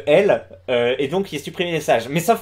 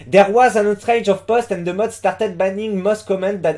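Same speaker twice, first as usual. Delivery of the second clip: English read with a thick French accent; basic French accent; 2 semitones higher than usual. Shown as a demonstration. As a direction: sound like a French speaker reading English. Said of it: "je tente l'accent français de base". (accent français de base en anglais)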